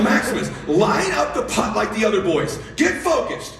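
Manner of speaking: high-pitched dad scolding; exasperated order. Retold or imitated. Dad to son lining up a putt, exasperated and high. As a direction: order him to shape up, exasperated.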